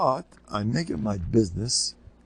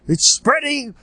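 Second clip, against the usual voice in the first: mock alarm; 5 dB louder, slower than usual. Newspapers hyping covid.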